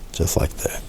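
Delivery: breathy whisper to himself. Real, not acted. Painter whispering to himself over the stroke. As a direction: murmur it under the breath while brushing. Painter murmurs while brushing.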